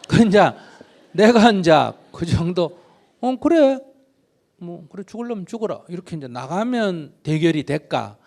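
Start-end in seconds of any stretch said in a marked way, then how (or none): 3.2-5.8 calling the bluff. Pretend. Parent calling the child's bluff.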